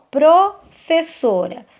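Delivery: "professora" slowed, with a break after "pro"; slow, divided "professora"; syllable break. Shown as a demonstration. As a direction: show where "professora" splits, pausing after the first syllable. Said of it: "A sílaba eu não posso quebrar".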